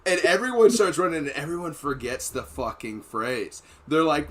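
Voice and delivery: ordinary talk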